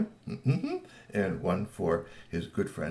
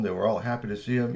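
plain reading voice

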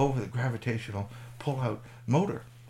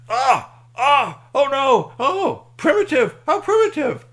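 high, loud shrieking panic; comic alien freak-out, very high and loud; horrified alarm; 12 dB louder, slower than usual. Acted. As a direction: recoil in exaggerated horror. Alien horrified at seeing a fire.

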